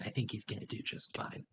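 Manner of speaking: soft voiceless reassurance; whispering. Acted. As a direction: whisper it kindly.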